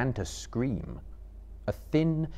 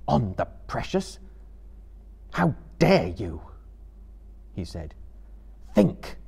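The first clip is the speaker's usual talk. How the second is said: stern, raised, indignant voice; 5 semitones higher, 4 dB louder than usual. A narrator's reading. Frodo draws himself up at Gollum's oath, stern. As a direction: rebuke with grave force. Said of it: "his stern voice".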